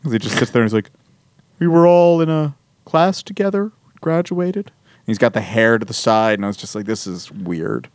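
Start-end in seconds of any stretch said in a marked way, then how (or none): 1.6-4.7 deadpan art-rock singer, lightly voiced. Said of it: "can you act like [name]". 6.9-7.9 muttered deadpan aside. Retold or imitated. Reacting to odd scene.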